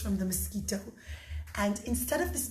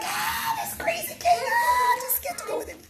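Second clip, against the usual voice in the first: very high, squealing frightened cry; scared people. Acted. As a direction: shriek away from the crazy king. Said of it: "people got scared".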